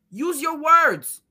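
very slow, word by word; slow scolding. Retold or imitated. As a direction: spell it out like to a child. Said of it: "I'm in the middle like".

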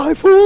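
high, loud jingle call; jingle sound effect. Acted. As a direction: belt it like an ad jingle. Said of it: "Fica aqui só fazendo minha sonoplastia". (efeito sonoro de vinheta bem agudo)

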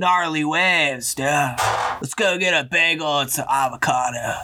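slow, drawn-out SNL Californian; California surfer accent. Shown as a demonstration. Husband performs the Californians skit on request, surfer accent. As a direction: stretch it out like a surfer dude. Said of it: "do the accent".

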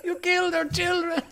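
high, hammed-up Spanish accusation; mock-passionate, high-pitched Spanish accent; sexy Spanish accent. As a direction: accuse them like a Spanish telenovela hero. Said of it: "My sexy Spanish accent".

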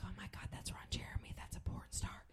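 hushed onlookers' whisper; imitated scandalized whisper; gossiping whisper. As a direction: whisper it like a starstruck bystander. Onlookers whispering about a celebrity.

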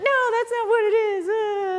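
very high-pitched mock whining; sad whine. Founders protest being misunderstood by the consultant, mock sad whine. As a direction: imitate dejected founders wailing in protest. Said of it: "and then they're all sad".

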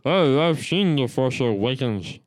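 slowed alien voice, like behind glass. Pretend. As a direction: speak like a space alien, slow and muffled. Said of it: "I went for my alien voice".

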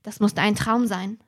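ordinary talk

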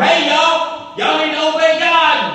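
high, loud careless call; mock Noah hollering a warning mid-task; shouting over his shoulder. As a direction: yell it back while busy working. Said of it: "was shouting over his shoulder".

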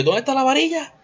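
high, loud panicked cry; frantic yell. Retold or imitated. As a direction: call out in alarm, way up high. Panicked after crash, searching.

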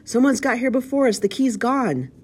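high, loud cry of alarm; pirate's cry. A narrator's reading. Pirate inside reports the missing key, crying out. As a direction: cry it out to the leader below. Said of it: "and then another cry".

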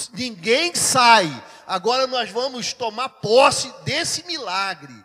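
loud, drawn-out proclamation; commanding preacher's shout. Retold or imitated. Pastor closing the service in cinema, loud commanding shout. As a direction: order the room with full-force preaching.

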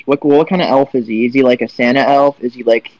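normal talking voice

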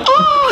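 very high mock squeal; high-pitched imitation of kids going wild; imitated freak-out scream. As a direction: squeal like an overexcited kid. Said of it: "freaking out".

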